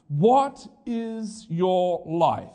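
very slow, weighed "What is your life?"; slow searching question. Acted. Preacher reading James to the congregation, very slow. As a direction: let each word hang.

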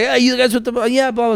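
a lightly voiced high street-pitch hustle; sales pitch voice. Pitching to students, a lightly voiced high sales spiel. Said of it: "start giving this spiel".

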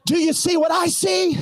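high, shouted question; shouted preaching. Acted. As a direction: fling the question at the crowd.

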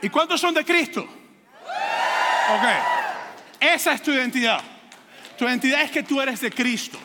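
rally the church to lift their heads; preaching at full force. Acted. Preacher exhorting the congregation. (predicación enérgica y en voz alta)

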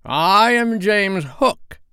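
slow, high, grand self-announcement; ringing pirate introduction; Hook. A narrator's reading. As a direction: proclaim the name in a mimicked captain's voice. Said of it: "replied the voice".

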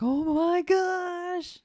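high, loud, slow drawn-out shock; mock gasp. Co-host mimics everyone seeing the hand, slow high gasp. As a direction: stretch it out in fake horror. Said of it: "Everybody's like".

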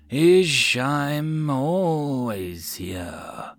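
very slow, rasping serpent voice; a sly ancient snake drawing out words; hissing serpent. Acted. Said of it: "The serpent rasped".

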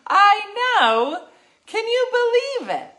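high, loud gushing agreement; excited. Retold or imitated. Telling Jacqueline about the crazy story, high and loud. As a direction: gush back like it's the juiciest gossip.

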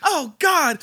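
loud high dismayed cry; frantic mother. Retold or imitated. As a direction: shriek it like a stressed mother. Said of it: "I just imagine her like".

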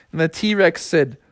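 ordinary delivery

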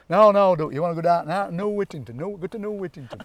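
put-on loud, high, broad Chesterfield accent; mock a Chesterfield local protesting loudly. Local sending researchers elsewhere, loud broad Chesterfield accent. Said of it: "sounds to me like you're talking Chesterfield".

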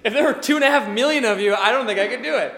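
amused disbelief; real laugh in the voice. Real, not acted. Laughing aside.

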